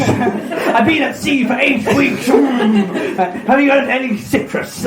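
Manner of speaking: high-pitched, hammed-up sailor voice; mock pirate. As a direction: play a comic scurvy sailor through laughter. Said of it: "Is that a scurvy reference?".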